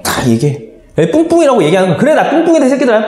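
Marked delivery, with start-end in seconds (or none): 2.0-3.1 defiant shout. Acted. Teen yelling back at classmates, defiant and laughing. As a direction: own the insult and throw it back.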